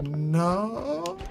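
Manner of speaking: drawn-out soft no, sliding up high; hesitant no. Retold or imitated. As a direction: stretch it softly upward, unsure. Reluctant answer to mobster's offer.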